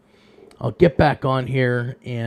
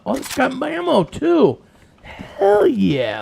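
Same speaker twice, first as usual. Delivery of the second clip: excited; 12 semitones higher, 4 dB louder, slower than usual. Real, not acted. Finds ammo for the gun.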